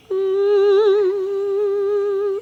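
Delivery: loud high steady hum; humming sound. Acted. Reading about hummingbirds, a high loud hum. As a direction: hum like beating wings.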